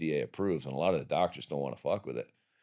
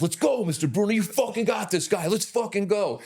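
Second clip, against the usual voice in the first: much higher, louder hype call; fired-up coach; 4 dB louder than usual. Retold or imitated. Coach hyping his fighter before the bell, high and loud. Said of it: "my coach is like".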